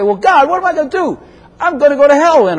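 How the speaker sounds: high, whiny voice of a doomed sinner; despairing sinner. Acted. Preacher voicing a hopeless sinner complaining to God, high and whiny. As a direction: whine it to God like a lost cause.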